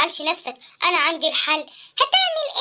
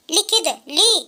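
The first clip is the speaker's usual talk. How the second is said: put-on much lower, gentle old man; wise old inventor voice; 8 semitones lower than usual. Uncle Abqarino asking the sad boy why, low. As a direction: ask like a kindly old uncle.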